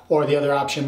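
normal talking voice

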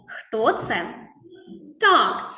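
ordinary talk, nothing marked

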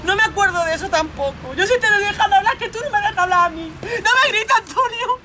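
tearful protest. Acted. Pamela begging Antonio not to shout at her, crying. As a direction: argue back through tears, climbing to a cry. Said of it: "Lloro porque me estás diciendo una cosa".

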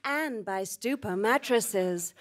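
ordinary talk

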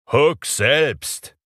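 loud high call from hiding; loud echoing call. Acted. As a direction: shout it down from above. Echoing voice answers back.

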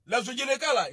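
ordinary talk

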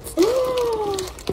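high, soft gasp of delight; excited gasp. Real, not acted. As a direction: gasp in awe. Sees the huge candies.

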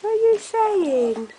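high, loud, slow coo; puppy talk. Real, not acted. Mum asking the puppy, slow high coo. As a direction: draw it out sweetly at the dog.

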